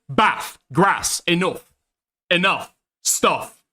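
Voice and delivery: Bath, Grass, Enough, Stuff with short flat northern vowels. Shown as a demonstration. Proving he's northern, flat short vowels. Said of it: "I am a northerner".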